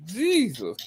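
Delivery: normal talking voice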